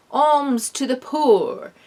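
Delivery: slow, loud, high mock-posh British accent; fake British accent. A narrator's reading. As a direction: lord it over the peasants in fake British. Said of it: "he said in a fake British accent".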